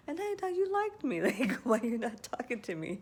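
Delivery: jokingly sulking at a friend who didn't call, high and loud; playful mock hurt. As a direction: whine it like a jilted friend as a joke. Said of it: "a way of joking".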